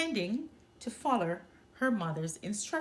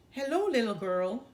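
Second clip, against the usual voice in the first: wolf growling; 4 dB louder than usual. A narrator's reading. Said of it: "the wolf growled".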